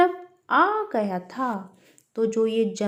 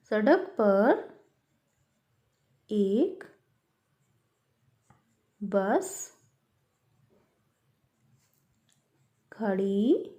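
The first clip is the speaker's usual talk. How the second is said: slow dictation; 3 semitones lower, much slower than usual. Shown as a demonstration. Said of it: "सड़क कैसे लिखते हैं".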